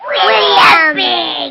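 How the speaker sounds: loud slow drawn-out announcement; announcing name. Real, not acted. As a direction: proclaim the name slowly and loudly.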